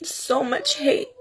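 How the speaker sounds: high, tearful voice breaking through the words; heartbroken, crying while speaking to viewers. Real, not acted. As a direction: talk through real tears, voice pinched high. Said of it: "I'm sorry for crying".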